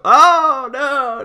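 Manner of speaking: ordinary talk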